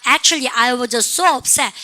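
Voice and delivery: so stretched in Actually, I was so upset; slow drill. Shown as a demonstration. Teacher modeling the sentence, slow with stretched so. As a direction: draw out "so" for the students. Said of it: "시작".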